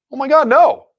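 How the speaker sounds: a high, loud, mock-shocked gasp of a line; retold shocked realization. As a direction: replay the moment it hit you, playfully.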